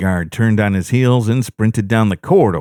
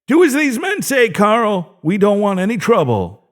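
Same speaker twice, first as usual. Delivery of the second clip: strained shout; 12 semitones higher than usual. A narrator's reading. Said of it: "mustered the strength to shout".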